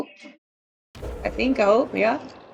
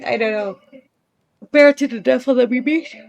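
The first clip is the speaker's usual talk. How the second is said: ordinary talk, nothing marked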